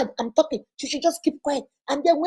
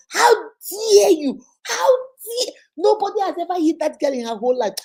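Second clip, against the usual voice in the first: crying outrage; 6 semitones higher, 4 dB louder than usual. Retold or imitated. Brother weeping over his hit sister, crying out. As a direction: cry out through tears. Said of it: "This man started crying".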